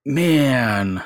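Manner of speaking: usual speaking voice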